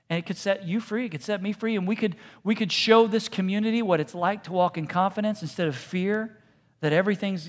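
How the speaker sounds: normal talking voice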